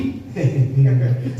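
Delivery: low demon laugh, acted out; mock sinister chuckle. Spirit unsettles the minister about to pray, low sinister chuckle. Replaying the possessed person's laugh.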